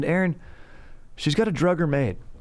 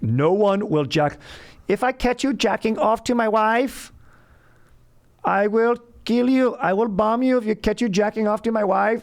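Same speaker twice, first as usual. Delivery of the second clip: hammed-up German accent, pitched way up; 9 semitones higher than usual. Jealous husband threatening men who watch his wife's movie, in a high German accent. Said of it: "That's my German accent".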